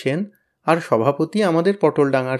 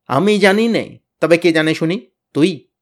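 angry challenge; 4 semitones higher, 6 dB louder than usual. A narrator's reading. Tenida threatening Pyala.

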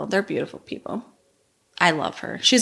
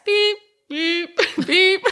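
mock censor beep; 11 semitones higher, much slower than usual. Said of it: "The beep is when you swear".

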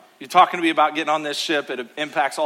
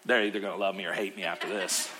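laughing aside; 8 semitones lower, 11 dB quieter, faster than usual. Real, not acted. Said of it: "laughing aside".

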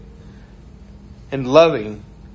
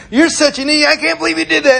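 high, loud angry rebuke; mad outburst; 5 dB louder, faster than usual. Retold or imitated. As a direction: lash out, raised pitch and volume. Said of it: "they came up to me mad".

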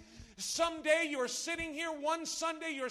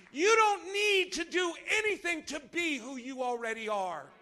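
loud, raised preacher's shout; full-force preaching; 3 semitones higher, 10 dB louder than usual. Real, not acted. Preacher telling children of God who they are, full-force preaching. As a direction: drive it home at full volume.

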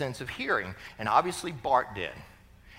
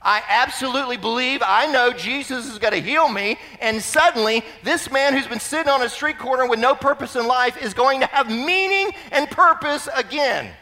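raised, loud, swelling conviction; full-force preaching; 8 semitones higher, 6 dB louder than usual. Acted. Preacher declaring the healed man's new purpose, fervently. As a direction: build to a fervent high point.

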